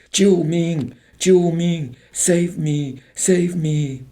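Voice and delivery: high, desperate cries for help; terrified cries. A narrator's reading. Girls being dragged off cry out in terror. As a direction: cry out for rescue. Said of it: "terrifying cries of".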